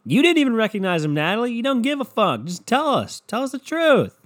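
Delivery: high-pitched, exasperated rant; mock outraged scolding. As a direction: call her out in hammed-up indignation. Mock scolding of the absent star.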